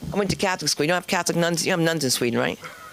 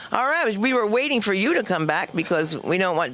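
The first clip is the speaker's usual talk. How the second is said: ordinary talk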